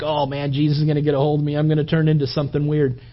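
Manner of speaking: fast, nervous rambling; a nervous quick aside; worried rush. Retold or imitated. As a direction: blurt the fear out quickly. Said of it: "You think".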